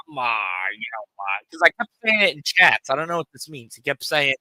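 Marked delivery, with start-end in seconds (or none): 0.1-1.4 low, groggy imitation; half-asleep voice. Retelling how he answered the phone, low half-asleep voice. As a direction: mimic someone talking half asleep. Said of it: "half the sleep voice".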